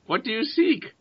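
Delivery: high-pitched bird croak; raven voice. Acted. Raven answers the party from above, high creature voice.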